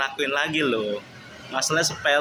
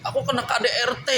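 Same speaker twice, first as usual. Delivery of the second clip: high-pitched imitation of the wife, protesting; mimicking wife; 9 semitones higher than usual. Husband mimics wife accusing him before her father, high-pitched. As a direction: raise the voice high like his wife refusing. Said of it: "retold wife's reply".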